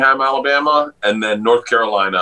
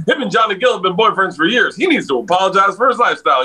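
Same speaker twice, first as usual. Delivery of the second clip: higher and fast, mock scolding; preachy bus driver; 8 semitones higher, faster than usual. Driver lecturing the comics on gossip, preachy. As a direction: rattle off the gossip like a sermon. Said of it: "He goes".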